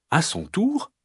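ordinary delivery, nothing marked

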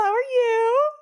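high tearful whine. Pretend. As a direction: ask it in a crying squeak. High crying tone.

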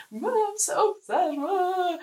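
high-pitched mock upset kid; upset child. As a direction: wail it up high like a boy calling his mom. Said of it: "And then he's like".

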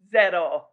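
"zero" isolated after a pause, falling firmly; stress on zero. Real, not acted. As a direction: repeat "zero" as a firm verdict. Repeats the word for emphasis.

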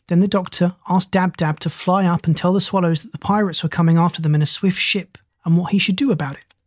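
plain reading voice

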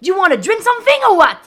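high, loud hollered offer; boat-style shouting. Acted. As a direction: holler it sailor-style across the deck. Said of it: "je leur dis façon bateau".

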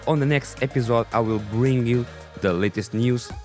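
ordinary talk

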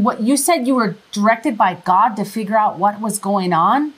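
normal talking voice

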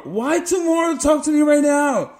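high, shouted insistence; impatient demand. Acted. Acting out demanding an answer from a boss, impatient shout. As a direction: shout it in hammed-up urgency.

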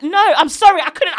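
exasperated, acted-out refusal; fed-up refusal. Worker refusing a demanding customer, fed up.

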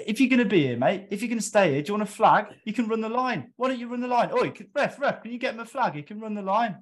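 high, loud imitated jeering; mock sarcastic rant. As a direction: jeer at the bloke like a wound-up player. Dan mocking the spectator.

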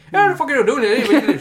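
hammed-up Irish accent, high and loud; proper Irish accent. Joking example of a proper Irish accent, loudly hammed up. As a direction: bellow it in a rough mock Irish brogue. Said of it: "a proper Irish accent".

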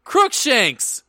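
high, loud theatrical cry; hammed-up spell-casting shout. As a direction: hurl it out like an incantation. Acted spell retort in story.

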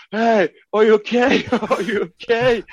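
mock-shocked, high-pitched check on someone; imitating shocked Oliver. Oliver checking on the others after the crash, mock-shocked. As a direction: put on a high, stunned voice, half laughing. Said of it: "Han var helt chockad".

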